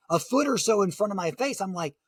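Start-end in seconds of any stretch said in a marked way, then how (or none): none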